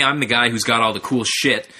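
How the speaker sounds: normal talking voice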